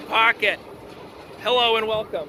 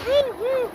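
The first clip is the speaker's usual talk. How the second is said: high whooping cheer; ride cheer; 13 semitones higher than usual. Real, not acted.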